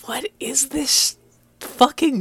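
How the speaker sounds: high, drawn-out exasperated disbelief; bewildered reaction. Retold or imitated. Fan reacting to odd story topics, bewildered. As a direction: strain upward in mock bewilderment.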